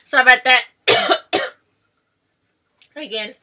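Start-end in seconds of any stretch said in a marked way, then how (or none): 0.7-1.5 short dry cough. Real, not acted. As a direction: cough dryly. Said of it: "Please ignore that".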